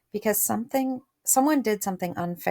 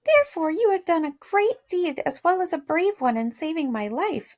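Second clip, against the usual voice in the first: mouse queen voice; 9 semitones higher, 3 dB louder than usual. A narrator's reading. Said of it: "added the queen".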